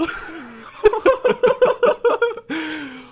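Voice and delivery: high, loud laughter; high burst of laughter. Real, not acted. Laughing at own story.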